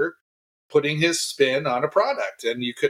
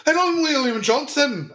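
introducing himself in a high, put-on Liverpool accent; a playful stab at a Liverpool accent, pitched well up; 12 semitones higher than usual. Co-host says his name on the podcast intro, in a put-on Liverpool accent. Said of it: "it's more of a Liverpool accent".

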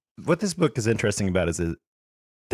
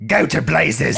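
screamed; 3 semitones higher, 6 dB louder, slower than usual. A narrator's reading. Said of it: "screamed the Trunchbull".

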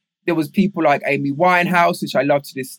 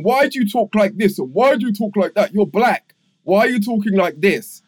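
higher-pitched imitation of teasing classmates; mildly mock them with incredulous questions; retold taunt, pitched up; mocking disbelief; 5 semitones higher than usual. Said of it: "people were laughing at me".

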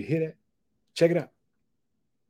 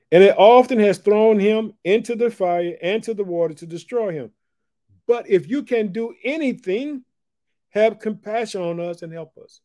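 raised, louder preaching read of the verse; preaching scripture; 9 semitones higher, 8 dB louder than usual. Acted. Preacher reading verse to church, raised and fervent. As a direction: proclaim the scripture to the congregation.

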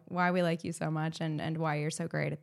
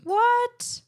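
high, loud stunned what; shocked surprise; 7 dB louder than usual. Real, not acted. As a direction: blurt it out in disbelief. Reacts to award news.

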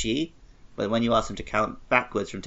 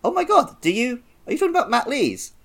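surprised; 12 semitones higher than usual. Retold or imitated. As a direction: voice jumps much higher in disbelief. Retelling his own surprised reaction.

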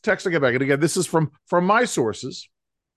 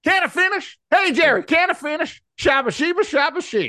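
much higher, twangy Ross Perot impression; 11 semitones higher than usual. Host told he sounds like Ross Perot, pitched-up impression. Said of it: "You sound more like Ross Perot".

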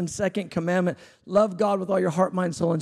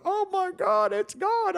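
amazed; slower than usual. Retold or imitated. Retold amazed reaction.

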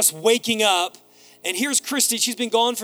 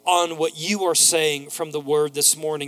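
measured, hushed public prayer, falling lower toward the amens; 4 semitones lower than usual. Real, not acted. Preacher asks God to bless the morning's word, praying.

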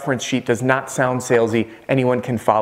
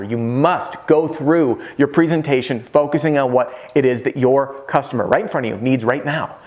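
normal talking voice

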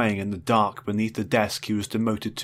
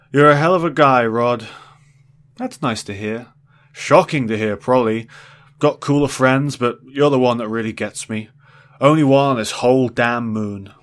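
put-on drunken rambling; 3 semitones higher, 8 dB louder than usual. Drunk boss rambling loudly.

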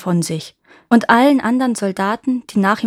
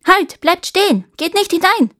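high, loud warning shout; urgent high-pitched call; 10 semitones higher, 5 dB louder than usual. A narrator's reading. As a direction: shout a halt at intruders. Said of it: "rief ihnen zu".

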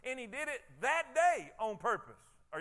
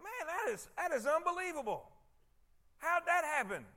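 amazed; 6 semitones higher than usual. Retold or imitated. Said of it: "why couldn't they just said".

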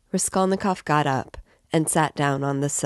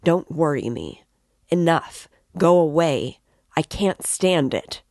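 rising to a louder, fed-up outburst; irritable shout; 2 semitones higher, 5 dB louder than usual. A narrator's reading. Raskolnikov to his mother and sister, a louder irritable outburst. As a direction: push them away, voice climbing to a shout. Son drives family away, irritated.

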